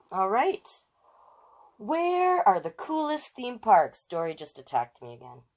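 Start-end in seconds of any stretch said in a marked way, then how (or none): 1.8-4.1 dramatic title read. Acted. Announcing the chapter title.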